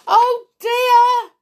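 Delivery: high, loud gasp of fright; frightened gasp. A narrator's reading. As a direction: cry out in sudden alarm. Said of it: "gasped Jack".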